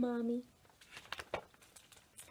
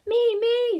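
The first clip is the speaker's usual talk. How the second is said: much higher, louder excited "me, me"; eager boys; 13 semitones higher, 11 dB louder than usual. A narrator's reading. Boys to Ruby, a much higher, louder eager shout. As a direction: jump at the chance.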